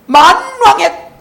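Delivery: preacher lifting up God's kingship; exalted proclamation. Real, not acted. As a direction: stretch it out in awe.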